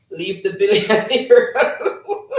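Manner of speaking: loud, slow, high laughing read-out. Real, not acted. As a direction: crack up loudly mid-sentence. Cracks up reading chat comment.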